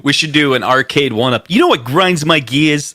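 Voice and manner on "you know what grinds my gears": light Peter Griffin catchphrase; a hint of Peter Griffin. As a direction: toss off the catchphrase in a cartoon dad voice. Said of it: "Peter Griffin".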